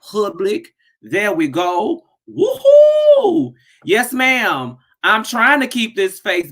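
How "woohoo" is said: high, loud joyful woohoo; excited whoop. Real, not acted. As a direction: whoop it out with delight. Excited greeting on live stream.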